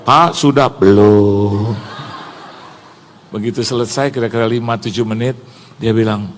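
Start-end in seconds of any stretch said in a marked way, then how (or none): 0.0-0.7 louder mock shout; calling out. Guide calls to the pastor in the toilet, mild shout. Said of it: "Dia teriak". 0.7-1.8 low, loud, long-held answer; winded reply. Retold or imitated. Winded pastor calls back from the toilet, comic low drawn-out reply. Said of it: "Ngos-ngosan".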